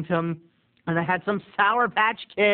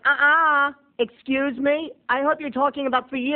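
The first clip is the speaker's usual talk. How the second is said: sassy objection; 8 semitones higher than usual. Retold or imitated.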